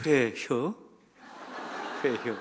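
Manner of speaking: short, easy Chungcheong "회혀?"; a clipped local offer in Chungcheong dialect. Retold or imitated. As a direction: Chungcheong way of asking "회혀?". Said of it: "저희는 이렇게 말해요".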